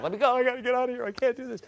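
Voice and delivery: hammed-up nervous bride pleading; panicky bride. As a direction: imitate a bride begging to flee. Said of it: "She was like".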